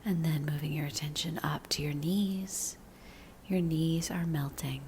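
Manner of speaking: slow, soothing meditation voice with long pauses; unhurried guidance, letting each phrase settle; guided body scan meditation. Real, not acted. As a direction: lead them gently into relaxing, drawing out the phrases.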